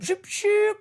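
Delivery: playful mechanical zoop. Retold or imitated.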